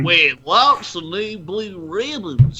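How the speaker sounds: slow put-on character voice; drawn-out character read.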